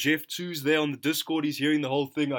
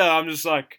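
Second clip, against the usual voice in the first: ordinary talk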